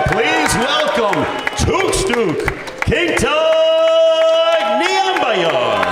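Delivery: big booming fighter introduction; ring announcer. Acted. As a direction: boom it out to the arena crowd.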